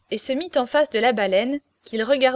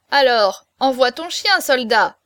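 ordinary delivery

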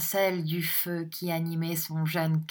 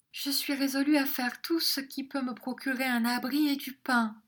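tearful; 8 semitones higher than usual. A narrator's reading. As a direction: pledge it through tears, pitched high. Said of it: "la jeune fille en pleurant". (jeune fille en pleurs, voix aiguë)

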